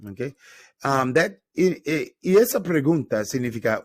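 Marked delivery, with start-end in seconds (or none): none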